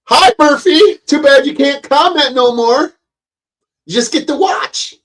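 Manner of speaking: high sing-song gloating; gloating taunt. Real, not acted. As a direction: rub it in his face. Taunting blocked viewer.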